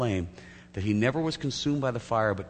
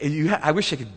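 usual speaking voice

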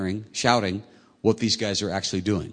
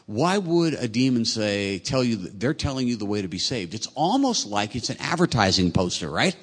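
ordinary talk, nothing marked

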